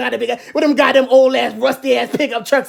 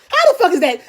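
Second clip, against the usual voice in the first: normal talking voice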